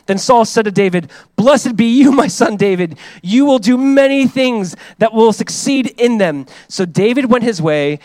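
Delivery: loud, high, proclaiming Bible reading; fervent scripture reading. Acted. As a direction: read it out like from the pulpit. Reads Saul's blessing.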